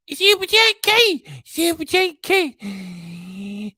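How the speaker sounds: squeaky singsong cat; high cat puppet voice. Pretend.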